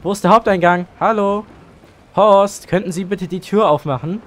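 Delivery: shouting to be let in; loud call at the door. Pretend. Calling at locked door.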